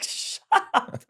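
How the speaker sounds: whispered protest to joking dad; whispered shut up. Retold or imitated. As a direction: hiss it through a laugh. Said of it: "I was like".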